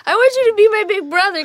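high, fast, excited plea; gushing plea. Retold or imitated. Actress gushing to a friend about a funny co-star, high excited plea. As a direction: gush like a fan begging.

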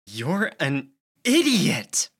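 loud, slow raw insult. A narrator's reading. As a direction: hurl it out slowly, voice raw with feeling. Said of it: "voice raw".

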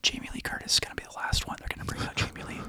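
fast hushed whisper of a rumor. Acted. Storyteller drawing the listener in, whispered fast. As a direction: lean in and whisper it like a secret. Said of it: "You're doing that D Wallace thing".

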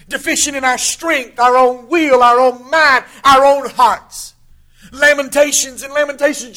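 preacher pressing the point home; preaching at full force. Acted. Preacher driving home human weakness, loud full-force preaching.

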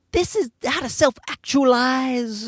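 high, slow self-help preaching; drawn-out, lifted motivational patter; gushy positivity guru. Acted. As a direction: parody an upbeat life coach. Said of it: "she's sort of like a positive-y".

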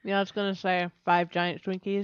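talking with ice in mouth; words slurred around ice cubes. Real, not acted. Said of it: "I got ice in my mouth".

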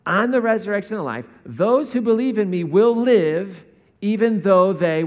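slow, loud and high, each word weighed; slow emphatic reading. Shown as a demonstration. Pastor reading Jesus's words slowly and loudly. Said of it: "I wanna read this slow because this makes no sense".

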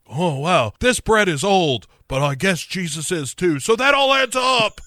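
high, excited, ending in a shout; a wild-eyed rambling voice; manic Nicolas Cage. Acted. Voicing Cage eating bread.

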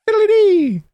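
goofy falsetto mock curse; silly squeak. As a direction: yelp it in a cartoonish high voice. Silly exclamation after mock curse.

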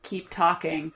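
can't-be-bothered sigh of a line; apathetic drone. Retold or imitated. Host voicing Morla as utterly uninterested. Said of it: "And she's just like".

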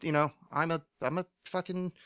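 normal talking voice

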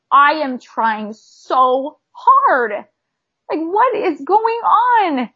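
slow, high, strained cry to Heavenly Father; reenacted crying plea. Pleading aloud to Heavenly Father, half-relived crying plea. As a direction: stretch each word out like sobbing a prayer. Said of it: "I was, like, scream crying".

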